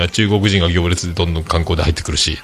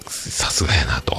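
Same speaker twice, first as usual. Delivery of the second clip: whisper; 6 semitones lower, 7 dB quieter than usual. Real, not acted. Whispered admiration aside.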